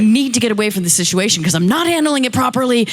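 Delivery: high, fast re-enacted rant; retold shouting. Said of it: "so then I shouted again".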